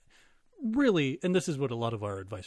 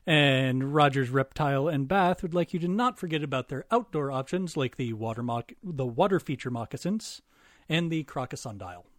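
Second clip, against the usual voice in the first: ad read; 4 dB louder than usual. Acted. Reads sponsor ad.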